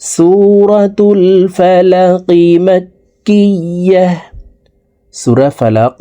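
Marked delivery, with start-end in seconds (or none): 0.0-4.1 slow, drawn-out chanted recitation; Quranic recitation. Shown as a demonstration. Teacher reciting to students, slow melodic tajweed chant. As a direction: recite it melodically, stretching each vowel.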